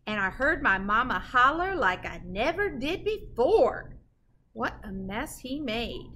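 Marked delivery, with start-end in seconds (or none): none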